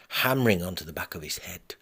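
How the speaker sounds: plain reading voice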